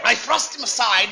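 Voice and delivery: ordinary talk, nothing marked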